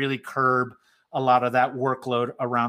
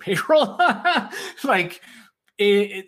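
laughing; 6 semitones higher, 4 dB louder than usual. Real, not acted. Laughing at own absurd budget line.

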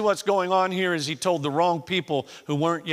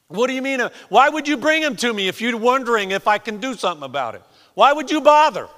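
loud, high indignant questioning; irritated; 9 semitones higher, 10 dB louder, faster than usual. Acted. Jesus challenging the father who doubts him, irritated. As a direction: fire the questions back in irritation. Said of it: "He's still a little irritated".

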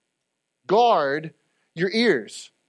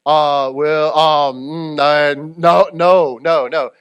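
loud, low hemming and hawing; flustered response; 4 semitones lower, 7 dB louder, much slower than usual. Retold or imitated. Gossiper answering the question, flustered and hammed-up. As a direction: stammer through a caught-out denial, loudly. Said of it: "they're gonna respond".

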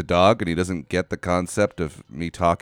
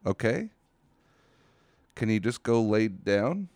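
usual speaking voice

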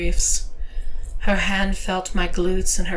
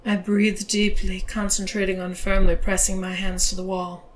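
plain reading voice

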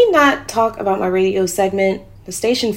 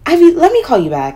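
much higher and louder, rushing off the phone; excited hurry; 9 semitones higher, 8 dB louder than usual. Acted. Woman ending a call with her friend, loud and excited. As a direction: cut the call short, keyed up.

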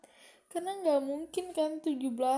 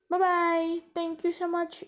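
loud, higher sing-song goodbye; cheerful bye; 5 semitones higher, 5 dB louder than usual. Real, not acted. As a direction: wave the viewers off brightly. Signing off to viewers.

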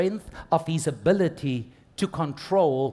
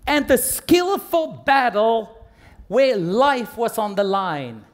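high, forceful sermon delivery; raised, emphatic preaching voice; preaching at full force; 4 semitones higher, 6 dB louder than usual. Acted. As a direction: drive each phrase home to the congregation.

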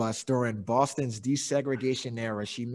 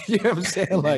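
laughing through the question, higher and louder; can't hold the laugh in while asking the friends; 6 semitones higher, 6 dB louder than usual. Real, not acted. Laughing it off while asking the co-hosts, higher and louder. Laughing about tombstoning his daughter.

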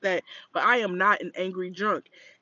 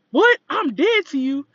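put-on loud high outraged drunk voice; mock the drunk taking offence; drunk indignation; 9 semitones higher, 6 dB louder than usual. Imitating drunk Karen's reaction.

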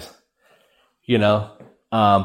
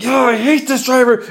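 much higher, louder angry squeal; high mom voice; 13 semitones higher, 4 dB louder than usual. Retold or imitated. Son imitates his road-raging mom, high and loud. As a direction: imitate mom yelling at another driver, high. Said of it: "she'll be like".

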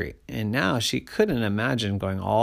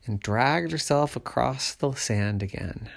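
ordinary delivery

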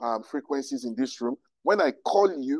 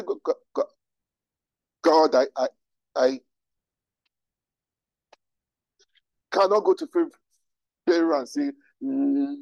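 Moses stuck on his words, stuttering with long halts; halting, hammed-up stutter; acted stammer. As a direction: get blocked on the first syllable and struggle onward. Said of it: "I can't talk".

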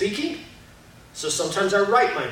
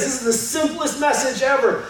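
ordinary delivery

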